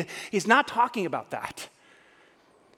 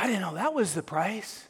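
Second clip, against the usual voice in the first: normal talking voice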